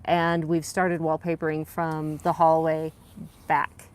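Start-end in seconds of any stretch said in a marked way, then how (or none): none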